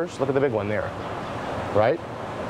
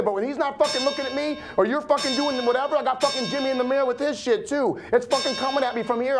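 pitched much higher, racing and wound up; manic rant; 10 semitones higher than usual. Acted. Man ranting about the money and the danger, manic and wound up. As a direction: spill it out like a paranoid man spinning. Long paranoid rant held high.

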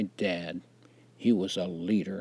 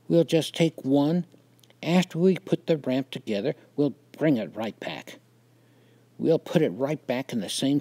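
put-on higher-pitched, wheedling boy; eager persuasion in a raised voice; coaxing; 6 semitones higher, 5 dB louder than usual. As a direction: coax him lightly, pitched up and pushy. Big boys coax the narrator.

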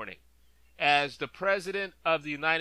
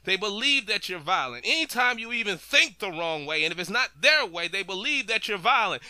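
ordinary talk, nothing marked

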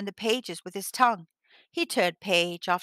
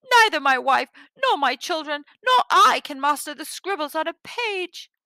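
high, loud, anxious protest; very frightened; 7 semitones higher, 6 dB louder than usual. A narrator's reading. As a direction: protest high and worried, hitting each item. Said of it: "were very frightened".

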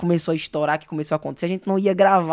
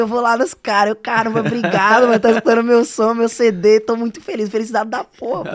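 loud, higher-pitched reenactment of overjoyed thanks; felicidade eufórica; 4 semitones higher, 7 dB louder than usual. Retold or imitated. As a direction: gush with thanks, voice raised. Retells thanking the guys, overjoyed. (agradecimento eufórico, encenado em voz alta)

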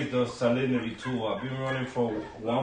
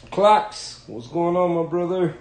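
loud greeting; 6 semitones higher, 8 dB louder than usual. Real, not acted. Calling out greeting to friend.

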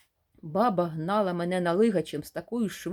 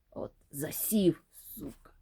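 slow hushed whisper; sneaking hunter's whisper; 3 semitones lower than usual. Acted. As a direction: whisper so the boar won't hear.

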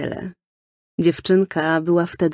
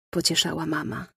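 plain reading voice

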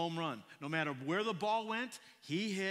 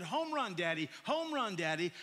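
put-on child's shout; 3 semitones higher, 5 dB louder than usual. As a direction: yell it like a stubborn little boy. Child insisting he scored.

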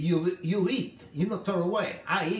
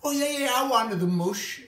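excited high voice; 8 semitones higher, 4 dB louder than usual. Retold or imitated. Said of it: "I said".

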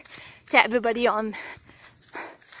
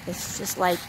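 awed hush; 3 semitones lower, 9 dB quieter than usual. Real, not acted. Awed at fair, hushed.

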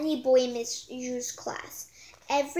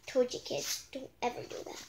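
whispered aside; 6 dB quieter than usual. Real, not acted. Reader whispering a warning to the kids listening.

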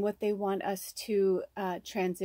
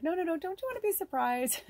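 much higher playful teasing voice; teasing spirits; 10 semitones higher than usual. Retold or imitated. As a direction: tease like a coy spirit. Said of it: "they're like".

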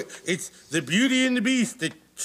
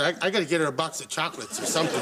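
ordinary talk

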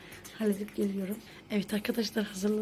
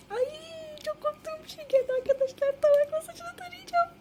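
much higher, playful dread; mock fear; 4 dB louder than usual. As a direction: squeal it like a scared kid. Dreads the comb task.

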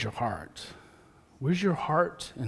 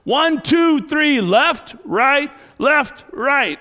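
loud, high drill-call shout; coach shouting; 11 semitones higher, 10 dB louder, much slower than usual. Retold or imitated. Gridiron coach calling dance steps to nervous boys, shouting. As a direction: bellow the steps like a football coach. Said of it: "And he goes".

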